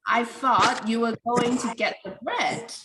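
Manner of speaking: "you" falls as new information, "bread" lifts at the end; rising tone on bread. Shown as a demonstration. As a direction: let the pitch rise on "bread" as already-known information. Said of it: "you pronounce it with the rising tone".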